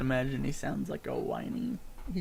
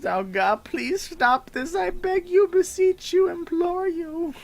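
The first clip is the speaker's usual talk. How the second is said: high, loud begging; pleading goblin; 6 dB louder than usual. Acted. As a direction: beg with rising desperation.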